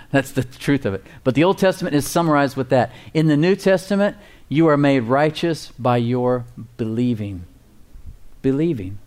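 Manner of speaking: ordinary talk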